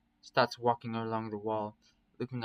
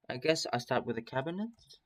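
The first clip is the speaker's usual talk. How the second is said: "I guess I start with the cabinet?" lifting sharply on "cabinet"; rising, questioning end. A narrator's reading. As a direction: turn it into an unsure question, pitch climbing on "cabinet". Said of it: "My voice goes up at the end".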